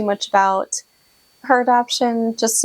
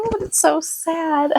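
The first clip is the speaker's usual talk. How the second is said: higher and slow, voice catching on the tears; tearful; 9 semitones higher, slower than usual. Real, not acted. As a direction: let it crack into near tears. New mother tearing up over baby.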